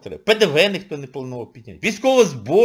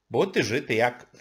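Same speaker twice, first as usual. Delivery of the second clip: ordinary talk, nothing marked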